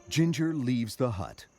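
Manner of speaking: ordinary delivery, nothing marked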